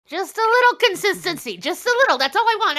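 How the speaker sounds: exasperated plea. Real, not acted. Cohost pleads with the others for some consistency, exasperated and loud. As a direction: throw up your hands and plead.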